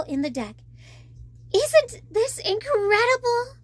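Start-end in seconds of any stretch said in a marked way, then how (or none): none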